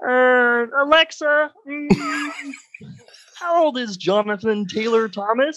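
high humming frog-puppet voice; Kermit the Frog. Acted. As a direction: squeeze out a high, hesitant puppet impression. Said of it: "that was an incredible impression".

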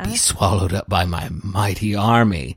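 hint of a gloating anime villain; lightly voiced villain boast. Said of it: "like an anime villain".

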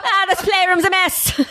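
drawn-out hammed-up gangster voice; Irish gangster. Mother talking to her imagined son, joking Irish gangster. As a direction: play a mock gangster, rising on the complaint. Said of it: "like he was an Irish gangster".

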